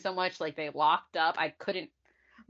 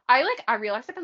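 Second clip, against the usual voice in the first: ordinary talk, nothing marked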